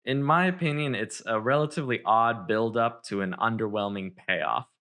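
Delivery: ordinary talk, nothing marked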